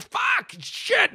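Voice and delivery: squeaky, high-pitched swearing; high frustrated squeal. Retold or imitated. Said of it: "I was like".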